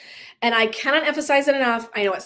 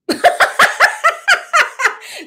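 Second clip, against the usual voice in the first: loud high laugh; 9 dB louder than usual. Real, not acted. Both cracking up.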